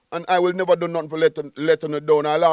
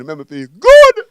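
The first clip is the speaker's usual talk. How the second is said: belted out loud, full of joy; loud happy proclamation; joyful shout; 14 dB louder than usual. Real, not acted. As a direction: shout the good feeling out.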